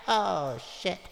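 ordinary delivery, nothing marked

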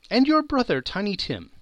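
fretting aloud over the missing father and son; anxious call. A narrator's reading. Mother fretting over her missing family, high and loud. Mrs Cratchit's worried question.

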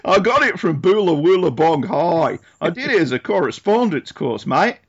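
hammed-up Aussie accent, matey; put-on Australian accent. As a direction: play a mock Australian bloke, accent laid on thick. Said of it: "trying to do an Australian accent".

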